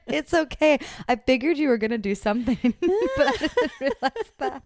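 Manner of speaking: can't hold back the laugh while quoting her. Real, not acted.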